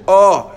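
O as a loud, rounded "oh"; loud closed "oh" for O. Shown as a demonstration. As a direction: say O round and strong.

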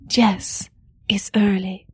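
lightly put-on Latin accent, pausing after yes; imitated Latin accent. Retelling Maria's reply to Tony, imitated Latin accent. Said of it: "He said in a thick Latin accent".

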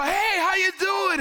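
high, loud, overly friendly hail; cheery greeting. Retold or imitated. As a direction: call out brightly across the church. Greeting the offended brother.

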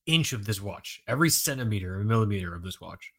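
ordinary delivery

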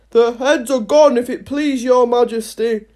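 loud soldier's report; mild soldierly shout; shouted reply. A narrator's reading. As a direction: call it back to the queen. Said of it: "the soldiers shouted in reply".

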